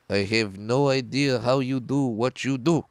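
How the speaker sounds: put-on slow, heavy Russian accent; thick Russian accent. Russian hand balancer backstage praising the comedian, in a slow thick Russian accent. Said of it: "in a thick Russian accent".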